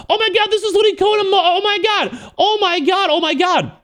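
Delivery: very high-pitched, gushing freak-out; excited outburst. Retold or imitated. Other people reacting to the same person, excited high squeal. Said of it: "So I got excited from that".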